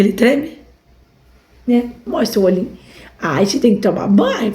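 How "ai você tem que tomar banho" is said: voicing the dog. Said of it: "Fala pro povo".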